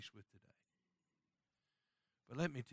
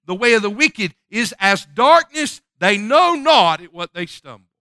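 loud, high preacher's cadence; thundering sermon voice; full-force preaching; 6 semitones higher, 9 dB louder than usual. Acted. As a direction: drive the warning home from the pulpit.

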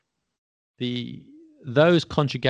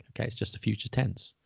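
ordinary talk, nothing marked

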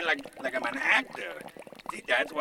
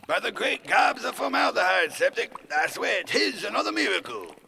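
loud, high theatrical proclamation; ringing, hammed-up exclamation; grandiose archaic speech; 6 semitones higher, 5 dB louder than usual. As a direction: declaim it like a melodrama oath. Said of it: "Why am I talking like this".

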